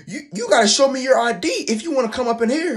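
high-pitched mock older-lady voice; put-on high voice, demanding; imitating the coworker. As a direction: pipe up shrilly like a wary woman behind a door. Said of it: "she was talking behind the door".

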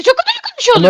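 scared. Real, not acted. Scary game moment.

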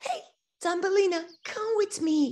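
high, quick, bright call; eager swallow. Acted. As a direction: swallow urges Thumbelina to come with him, high quick invitation. Swallow invites Thumbelina.

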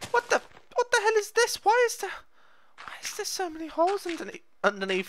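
very high, close to breaking. Real, not acted. Spotting strange holes in his build, voice shoots high. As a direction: let the voice crack up high in alarm. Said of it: "whenever my voice goes that high lately".

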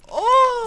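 high, loud "oh" outburst; loud cry. Real, not acted. Outburst before a taunting threat.

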